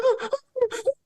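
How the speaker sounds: very high mock sobs; squeaky whimpering, put on for the story; imitated crying. Storyteller showing how the girl cried, high mock sobbing. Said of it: "she was just crying".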